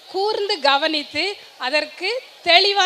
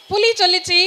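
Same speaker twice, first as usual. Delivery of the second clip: usual speaking voice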